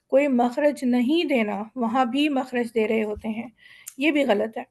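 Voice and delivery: usual speaking voice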